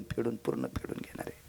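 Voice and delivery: very soft, whispered repetition; hushed warning. Acted. Preacher warning the debt will be fully repaid, hushed. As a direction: let the words sink to a whisper. Repeated phrase fades into whisper.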